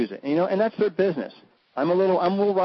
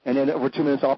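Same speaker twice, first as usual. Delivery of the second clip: usual speaking voice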